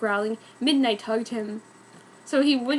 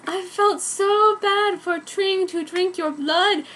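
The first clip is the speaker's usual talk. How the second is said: mock crying; 6 semitones higher, slower than usual. A narrator's reading. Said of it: "she strictly cried".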